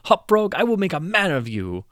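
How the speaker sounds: loud, high patronizing praise; delighted king. Acted. The king grandly praises Hopfrog, loud and high. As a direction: reward him with booming favor.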